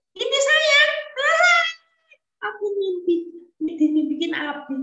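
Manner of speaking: put-on high, curious child's voice; a boy pestering his father with questions. Young Ismail asking his father about the dream in a high child voice.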